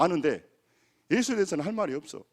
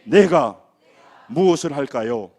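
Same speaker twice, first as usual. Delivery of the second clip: loud prayer cry; 2 semitones lower, 11 dB louder, slower than usual. Shown as a demonstration. Pastor leading the congregation in a prayer cry, loud. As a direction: cry out each phrase loud, with pauses. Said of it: "따라서 합니다".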